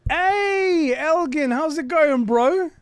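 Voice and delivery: real excitement at seeing a friend; excited greeting. Real, not acted. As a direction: call out a delighted hey to a buddy.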